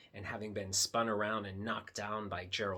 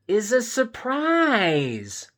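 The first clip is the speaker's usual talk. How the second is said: slow, loud, high proclamation; gleeful teasing; 12 semitones higher, 14 dB louder, much slower than usual. Acted. Piggy keeping a secret from Gerald, slow gleeful proclamation. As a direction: draw it out and swell loud on the end.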